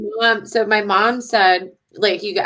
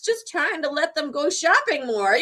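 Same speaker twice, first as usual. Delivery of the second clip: sheepish self-quote; 6 semitones higher than usual. Retold or imitated. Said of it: "I'm like".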